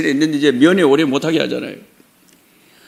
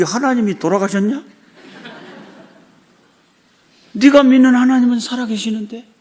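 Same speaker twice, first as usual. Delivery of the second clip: reassuring God; 5 semitones higher than usual. Retold or imitated. God assuring the son that the living God will help him, reassuring and raised. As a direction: lift him up with a confident reassurance.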